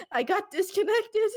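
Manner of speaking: high laughing explanation. Real, not acted. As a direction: say it while still laughing. Laughing about disconnect.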